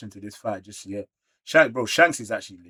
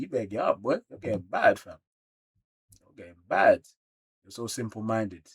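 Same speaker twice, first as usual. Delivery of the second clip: ordinary delivery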